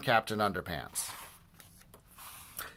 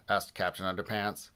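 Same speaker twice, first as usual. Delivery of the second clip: ordinary delivery, nothing marked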